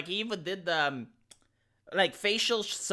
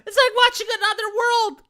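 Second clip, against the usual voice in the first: disbelief; 13 semitones higher, 6 dB louder than usual. Real, not acted. Stunned by clip on identity.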